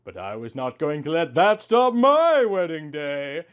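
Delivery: ordinary delivery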